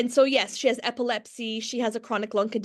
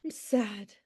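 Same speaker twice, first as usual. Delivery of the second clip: mock sad; 4 semitones higher, slower than usual. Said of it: "be laughing and go".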